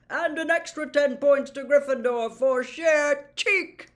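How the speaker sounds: put-on jovial, booming old professor; plummy teacher inspecting the class's work; Slughorn's voice. Slughorn to the class, checking potions, jovial booming.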